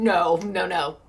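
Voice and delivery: usual speaking voice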